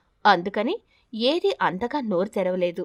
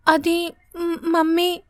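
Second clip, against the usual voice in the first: put-on high, small child voice faltering; a frightened girl stalling before she answers; hesitant little girl; 7 semitones higher, 4 dB louder, much slower than usual. (భయపడి తడబడుతున్న చిన్నారి గొంతు)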